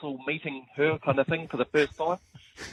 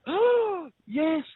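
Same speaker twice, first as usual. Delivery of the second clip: high, loud, pleased "oh, yes"; a squealed, pleased "oh yes"; mock delighted German woman; 12 semitones higher, 4 dB louder than usual. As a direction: do her sudden relief at finding the patch. German girl voiced in story.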